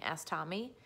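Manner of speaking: plain reading voice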